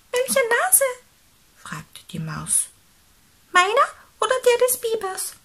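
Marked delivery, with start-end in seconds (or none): 0.1-0.8 very high, squeaky mouse voice; loud little squeak; little mouse, puzzled. Acted. 3.5-5.3 high, quick, squeaky mouse; loud, fast mouse squeak; little mouse, questioning. Acted. Reader voices the mouse.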